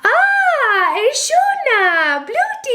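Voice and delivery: very high, drawn-out Simlish squeal; pleased Sim. Acted. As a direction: coo in high-pitched gibberish like a delighted Sim. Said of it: "when you do something nice and they're like".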